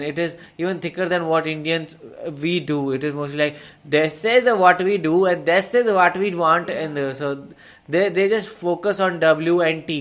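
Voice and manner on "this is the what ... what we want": imitating a thick South Indian accent, a bit louder; mock South Indian accent, lightly put on. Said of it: "So this is how South Indians talk".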